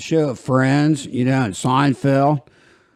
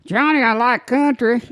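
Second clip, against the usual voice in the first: higher, lightly put-on voice of an eager passenger; mock passenger voice; 11 semitones higher than usual. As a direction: imitate a customer gushing about country, pitched up. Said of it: "people say".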